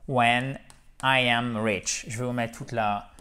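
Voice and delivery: usual speaking voice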